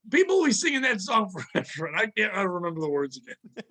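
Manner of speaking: ordinary talk, nothing marked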